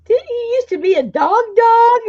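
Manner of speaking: high teasing voice to the dog; talking to the dog. Acted.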